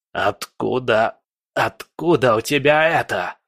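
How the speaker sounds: hoarse, halting villain voice; horrified croak. A narrator's reading. Villain horrified by his foe's portal, hoarse. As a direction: croak it out in dread, stretching the first word. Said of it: "прохрипел Легба в ужасе".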